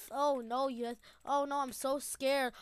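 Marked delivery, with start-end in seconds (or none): none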